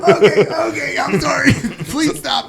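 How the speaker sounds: high, choked-sounding mock begging; panicked pleading. Retelling begging his choker to stop, high panicked plea. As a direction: beg frantically in a high voice, hammed up.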